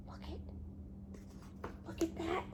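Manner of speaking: breathless unvoiced whisper; excited whisper. Real, not acted. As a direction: whisper in delight.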